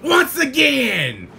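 loud, drawn-out battle yell; fighting shout. Real, not acted. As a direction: roar it while landing a hit. Knocking down an opponent.

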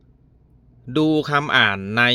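reads the exercise words out very slowly; slow reading. Shown as a demonstration. Teacher reading the exercise line to pupils, very slow. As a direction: drag each word of the instruction out. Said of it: "อ่านและจำเป็นคำ".

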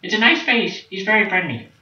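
very high, squeaky mock child voice; little son. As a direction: pipe up in falsetto like a contented kid. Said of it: "you be the son".

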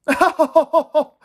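high, breaking out of a chuckle; laughing. Acted. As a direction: laugh into the word. Jack rolls over laughing after game.

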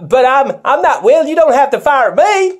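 mock panic. Toy actor pleading with troopers not to shoot him. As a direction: squeal it like you're being shot at. Interviewer panics under fire.

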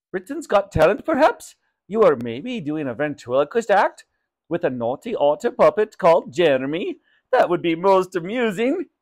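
eager shopkeeper character voice; lilting, lively guesswork in character; Raj. Acted. Shopkeeper guesses at mother's talent act, eager.

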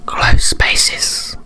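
voiceless, drawn whisper; creepy whisper. Acted. Player hamming up the ghost's phrase, creepy whisper. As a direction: whisper it spookily for effect. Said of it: "I don't know why I did that".

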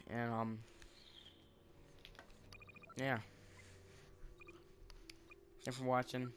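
talking while crunching cashews; chewing between words; crunching chips. Real, not acted. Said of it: "can hear me crunch the cashews".

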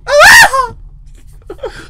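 high, very loud hammed-up ecstatic scream; mock sex scream. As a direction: joke shriek of pleasure. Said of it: "that's my sex scream".

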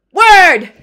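booming "Word!" declaration; loud shout. Pretend. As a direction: bellow it like an oracle. Declaring herself the Sphinx.

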